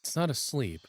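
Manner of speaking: steady, lightly hushed narration voice; calm second-person narration with a touch of tension; story narration. Acted. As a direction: read the scene like a storyteller building suspense. Reads game narration aloud.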